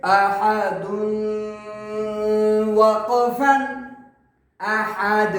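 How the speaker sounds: chanted letters, then a bouncing final dal; waqf demonstration. Shown as a demonstration. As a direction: recite it, stopping on a stilled د in احد. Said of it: "دال کو ساکن کریں گے۔".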